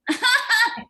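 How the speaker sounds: high loud laugh bursting out; a loud laugh breaking into the talk; burst of laughter. Real, not acted. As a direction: let a big laugh burst out. Loud laugh before calling out.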